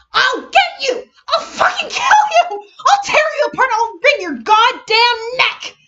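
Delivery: high, loud furious shrieks; shrieking rage. Acted. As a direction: scream every threat like a chained dog. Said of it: "she was yelling now, shrieking like an attack dog".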